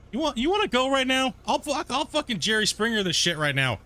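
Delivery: half-joking threat to the seller, pitched high; mock fight challenge. Real, not acted. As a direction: pretend to square up for a brawl. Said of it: "I'm getting all heated now".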